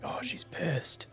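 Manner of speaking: slow, unvoiced nervous whisper. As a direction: whisper it slowly, wary. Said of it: "She's like".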